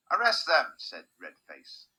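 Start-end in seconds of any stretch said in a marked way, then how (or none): 0.1-0.7 high, loud command; shouted order. A narrator's reading. Red-faced guard orders the children seized, shouted command. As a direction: order it at full voice.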